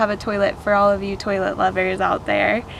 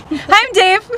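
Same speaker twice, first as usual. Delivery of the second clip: laughing; 9 semitones higher, 5 dB louder than usual. Pretend. Mock intro for camera.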